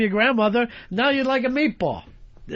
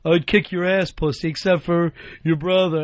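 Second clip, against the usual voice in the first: imitating bully; 4 semitones lower than usual. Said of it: "the fucking bully would say".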